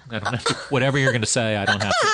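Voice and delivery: laughing through the retort. Retold or imitated. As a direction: laugh while refusing.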